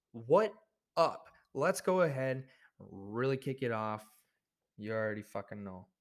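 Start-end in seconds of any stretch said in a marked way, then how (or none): none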